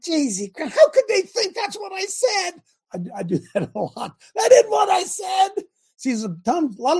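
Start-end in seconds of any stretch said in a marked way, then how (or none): 0.0-2.5 high, slow mock outraged shout; exasperated inner voice. Coach mimicking his frustrated self over an email, mock outraged shout. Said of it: "I'll think". 4.4-5.6 high, loud outburst; frustrated shout. Retold or imitated. Coach acting his frustrated self, high shout. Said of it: "I'll think".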